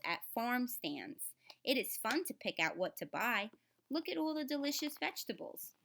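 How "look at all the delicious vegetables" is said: high and quick, selling the treat; enthusiastic. Acted. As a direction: delight the kids with it. Teacher enthuses to children.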